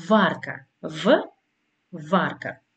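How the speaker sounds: βάρκα, then a lone v sound for Β, then βάρκα; pronunciation example. Shown as a demonstration. Teacher asking learners to try the word, demonstrating v in βάρκα. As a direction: isolate Β as "v" between separate takes of βάρκα. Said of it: "Δοκιμάστε να το κάνετε με τη λέξη".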